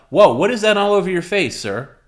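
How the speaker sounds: startled high loud whoa; surprised cop. Pretend. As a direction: react like a shocked officer. Role play officer surprised.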